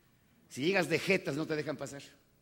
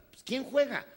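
incredulous question; 11 semitones higher, 6 dB louder than usual. Retold or imitated. Father questioning son about match.

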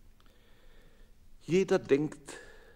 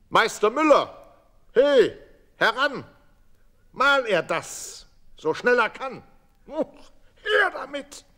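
calling out; 13 semitones higher, 12 dB louder than usual. Acted. Farmer calls the miller loudly.

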